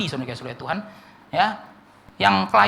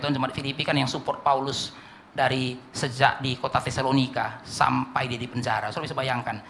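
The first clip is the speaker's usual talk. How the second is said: ordinary talk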